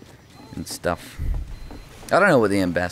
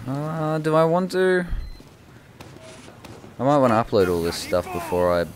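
ordinary talk, nothing marked

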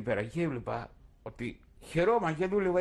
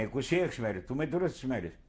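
usual speaking voice

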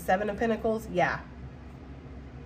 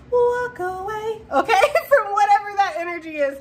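laughing advice; 10 semitones higher, 5 dB louder than usual. Real, not acted.

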